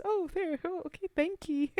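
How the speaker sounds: high-pitched mock-bashful acceptance; mock grateful drinker. Storyteller offered a beer mid-tale, mock grateful. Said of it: "And he says".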